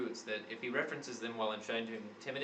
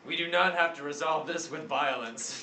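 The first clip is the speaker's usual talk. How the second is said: character plea; 5 semitones higher, 6 dB louder, slower than usual. Acted.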